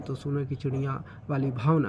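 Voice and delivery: ordinary delivery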